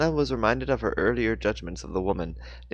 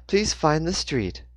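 slow, higher robotic monotone; monotonous GPS voice; 7 semitones higher, slower than usual. A narrator's reading. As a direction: read it like an annoying navigation box. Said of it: "in her annoyingly monotonous tone".

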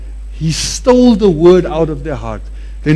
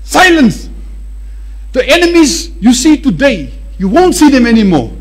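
higher commanding announcement; Moses commanding; 6 semitones higher than usual. Retold or imitated. Moses telling the panicking people to be silent, commanding. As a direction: proclaim to the people boldly. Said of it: "He says".

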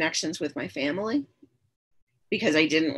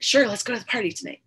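eager; 5 semitones higher, faster than usual. Retold or imitated. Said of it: "so I was like".

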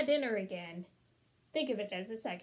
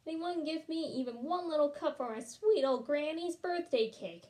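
usual speaking voice

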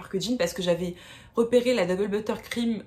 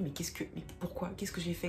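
détresse retenue; 10 dB quieter than usual. Retold or imitated. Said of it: "Je me dis".

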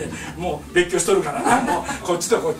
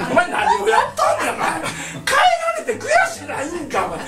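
much higher, incredulous telling-off; exasperated scolding. Retold or imitated. Father scolding his son for smiling after being benched, high exasperated rebuke. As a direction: chew out the boy for grinning.

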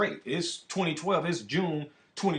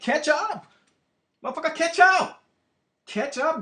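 angry shout; 10 semitones higher, 5 dB louder than usual. Real, not acted. Frustrated ranting at slow people.

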